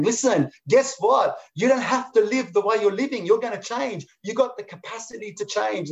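high, eager voice of the butterfly coaxing. Acted. Butterfly sitting with the caterpillar, urging it to believe, in a high, coaxing voice. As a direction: pitch up high and coax like a hopeful butterfly.

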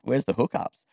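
ordinary delivery

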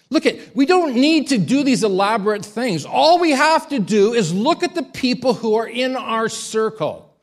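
high, loud preaching, slowing at the end; forceful preaching. Acted. Preacher rallying the congregation to witness, forceful. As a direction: build to a passionate climax.

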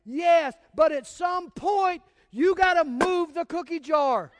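big laughing shout; loud punchline. Real, not acted. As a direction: belt it out, laughing at the point.

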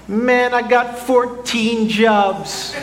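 high, slow, exaggerated Jamaican father voice; bad Jamaican accent. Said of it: "it was a terrible accent".

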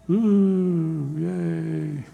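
limp, fading mock cheer; a weak, embarrassed little yay; half-hearted cheer. Congregation member answers the greeter with a feeble mock cheer. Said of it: "Yeah, sorry, Jesus".

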